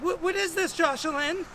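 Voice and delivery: high-pitched questioning mother; confronting mom. Retold or imitated. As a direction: confront your son, pitch climbing. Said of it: "She's like".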